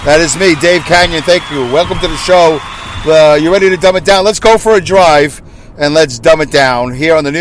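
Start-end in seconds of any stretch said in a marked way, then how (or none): none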